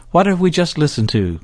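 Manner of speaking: normal talking voice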